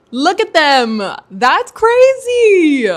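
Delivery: loud, drawn-out amazed cry; excited awe. Real, not acted. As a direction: gasp it out in pure delight. Awed at new books.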